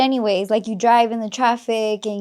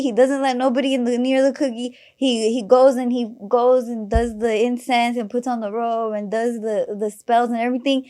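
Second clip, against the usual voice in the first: ordinary talk, nothing marked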